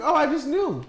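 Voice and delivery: high, airy mock cashier reply; breezy cashier. The cashier explains she just knew his name, breezy. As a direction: toss it off lightly. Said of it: "She was like".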